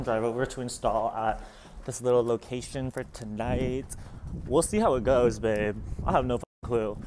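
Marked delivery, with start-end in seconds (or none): none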